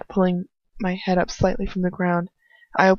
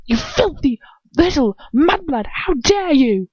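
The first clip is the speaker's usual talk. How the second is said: much higher, sneering rage; furious; 8 semitones higher than usual. Acted. Enraged wizard slapping the thief, furious sneer. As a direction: spit the insult at her. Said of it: "stares at me with such rage".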